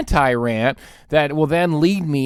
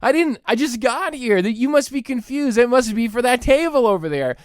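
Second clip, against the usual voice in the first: mock confused diner; 6 semitones higher than usual. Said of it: "You're like".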